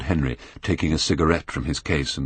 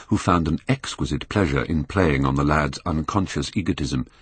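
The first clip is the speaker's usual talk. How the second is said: ordinary delivery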